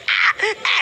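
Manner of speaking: high breathy mock groans like a woman in labour; mock moaning.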